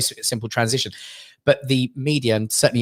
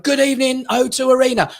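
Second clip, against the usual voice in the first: high, projected showman's call; arena greeting; 10 semitones higher than usual. Retold or imitated. New presenter greets the O two crowd, big high call. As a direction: hail a huge crowd from the stage.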